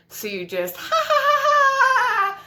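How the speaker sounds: shrill high laugh breaking into sobs and shouting; hysterical laugh-cry. Acted. Cornered by grandparent at dinner, laughing hysterically into tears. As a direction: laugh like you're about to break.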